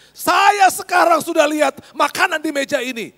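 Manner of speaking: high, loud astonished cry; retold shouting. Classmate suddenly seeing food on the table, shouting. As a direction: shout it out like an eyewitness. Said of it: "Sambil dia teriak-teriak".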